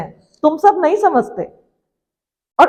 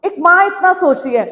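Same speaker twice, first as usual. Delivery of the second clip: ordinary delivery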